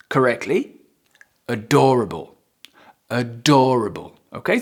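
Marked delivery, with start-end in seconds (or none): 1.5-4.2 ADORABLE with weight on DOR; careful model of ADORABLE, DOR stressed; stress on second syllable. Shown as a demonstration. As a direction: say a-DOR-able, leaning on DOR. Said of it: "make sure you pronounce it and you stress it".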